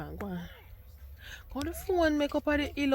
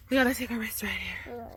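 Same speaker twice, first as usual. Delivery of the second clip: whisper. Real, not acted. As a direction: breathe it out in a whisper. Repeats line to toddler.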